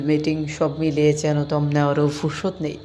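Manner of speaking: normal talking voice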